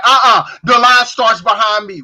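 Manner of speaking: mock barked objection, ready to fight; retold angry barking. Store worker to customer cutting ahead, imitated angry bark. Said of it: "this lady was barking like she was ready to fight".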